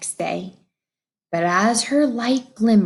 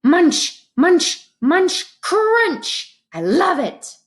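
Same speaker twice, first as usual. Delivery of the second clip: high, louder munching, rising each time; happy munching beetle; 9 semitones higher, 5 dB louder, slower than usual. Acted. Kiri the beetle enjoying his leaves, high and gleeful. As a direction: chomp with delight.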